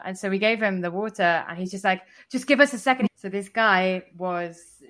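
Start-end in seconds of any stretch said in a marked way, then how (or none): 2.3-3.1 high, loud imitation of puffing; out of breath. Imitating the winded man who ran after them, breathless. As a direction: imitate someone winded begging for a pause. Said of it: "super out of breath".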